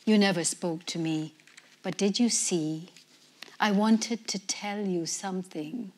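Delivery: plain reading voice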